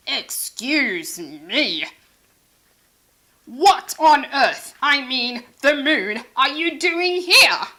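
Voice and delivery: loud, slow, indignant rival hippo; a haughty astronaut offended; pompous hippo. Acted. Pompous hippo scolds Sheila.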